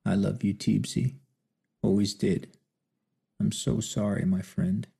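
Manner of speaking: weak dying croak. Acted. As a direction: croak it out barely. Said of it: "Billy opened his eyes and croaked".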